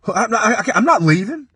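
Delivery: high, stammering protest; stubborn refusal. Retold or imitated. Stoned refusal to leave.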